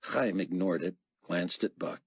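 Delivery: usual speaking voice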